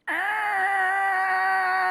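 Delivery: long, high, steady squeal; imitated chair screech. Girlfriend drags a chair back on a wooden floor, imitated screech. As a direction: imitate a chair scraping on wood in one held high note. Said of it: "pulls her, her chair back, like".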